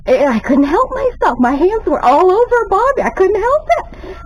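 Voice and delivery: giddy confession. Retold or imitated. Telling viewers her hands were all over Bobby, giddy and high. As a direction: confess it like you still can't believe yourself. Confessing she couldn't stop.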